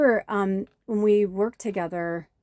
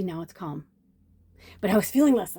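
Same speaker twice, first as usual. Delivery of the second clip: ordinary talk, nothing marked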